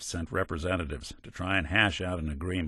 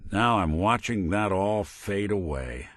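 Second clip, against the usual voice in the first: ordinary talk, nothing marked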